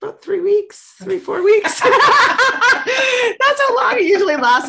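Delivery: high, loud laughing answer. Real, not acted. Laughing about her short flings.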